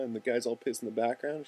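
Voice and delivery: ordinary talk, nothing marked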